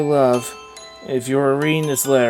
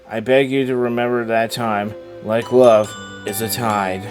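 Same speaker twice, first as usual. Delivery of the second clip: tender letter reading; 3 semitones lower, 6 dB quieter than usual. Acted. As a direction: read a dead wife's letter gently. Reads the dead wife's letter.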